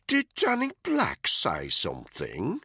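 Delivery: slow, loud jeering taunt; smug, nasty teasing; nasty mockery. A narrator's reading. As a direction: drawl it out to provoke. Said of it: "He smiled nastily".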